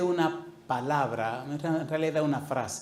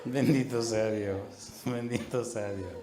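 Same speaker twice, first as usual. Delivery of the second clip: slow reverent blessing; 4 semitones lower, slower than usual. Real, not acted. Preacher's slow opening blessing.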